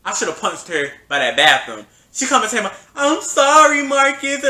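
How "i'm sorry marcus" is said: mocking apology.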